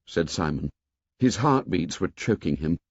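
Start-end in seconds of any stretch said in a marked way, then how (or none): none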